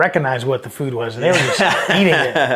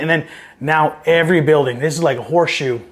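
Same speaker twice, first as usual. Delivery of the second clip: usual speaking voice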